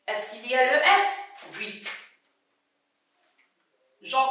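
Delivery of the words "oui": ordinary delivery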